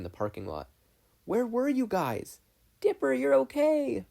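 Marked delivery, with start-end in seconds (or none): 1.2-2.4 put-on high, slow, worried boy's voice; high boy's call. Dipper calling out to his family, high and worried. 2.8-4.1 put-on high, loud, slow girl's voice; relieved girl. Mabel to her brother, high, loud and relieved.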